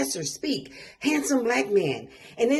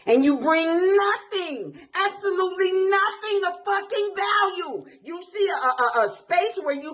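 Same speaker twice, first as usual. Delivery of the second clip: choked up and raging; tearful anger; 6 semitones higher than usual. Real, not acted. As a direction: voice breaking as the anger spills over. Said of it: "I get emotional".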